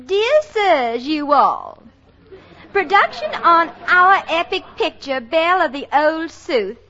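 mock Southern belle reading back a letter; a comic Southern belle voice; put-on Southern accent. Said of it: "a girl with a real Southern accent".

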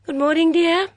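put-on high, lively voice of a fussy older lady; a bright, gossipy mother at breakfast; chatty Mrs Swettenham.

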